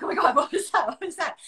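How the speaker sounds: half-laughing pretend panic; mock scared. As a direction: act spooked but giggle through it. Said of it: "I'll be like".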